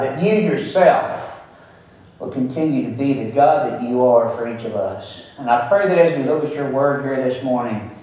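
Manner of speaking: pastor praying humbly over the morning's text; prayer. Acted. Pastor leads opening prayer.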